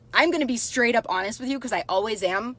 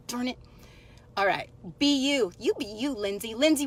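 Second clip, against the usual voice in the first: usual speaking voice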